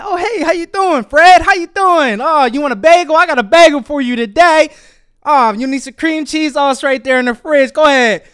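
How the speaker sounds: high, hearty, upbeat hello; happy greeting. Acted. Happy worker offering Fred a bagel at the door, cheerful. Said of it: "You happy".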